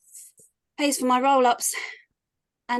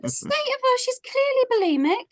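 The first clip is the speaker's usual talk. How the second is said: much higher sneering mock voice; mocking the mum; 12 semitones higher, faster than usual. [name] imitates the party mum to Tug, high and sneering. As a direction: lift way up and sneer like the snooty mum.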